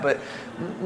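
ordinary talk

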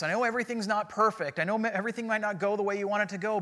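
ordinary talk

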